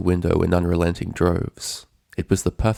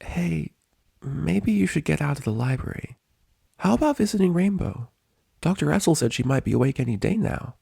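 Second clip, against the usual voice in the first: gentle Spike; 9 semitones higher than usual. A narrator's reading. Spike comforting Twilight after her nightmare. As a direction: coax her outside, kindly.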